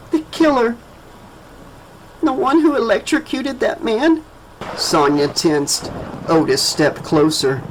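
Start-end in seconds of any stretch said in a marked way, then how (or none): 0.1-4.2 put-on high, soft child voice; a frightened little girl, softly. A narrator's reading. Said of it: "Her voice came out soft".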